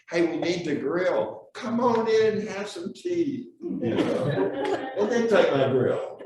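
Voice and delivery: cheerful Newfoundlander neighbor. Retold or imitated. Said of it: "he goes in his backyard and says".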